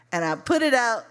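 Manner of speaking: ordinary delivery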